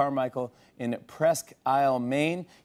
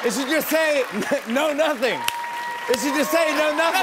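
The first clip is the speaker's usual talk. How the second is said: loud punchline; 12 semitones higher, 4 dB louder than usual. Real, not acted.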